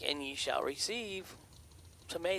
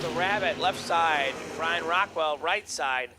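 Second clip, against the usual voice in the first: loud PA call of the pairing; race announcer; 4 semitones higher, 8 dB louder than usual. Acted. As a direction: announce the lanes boldly. Live drag race calling.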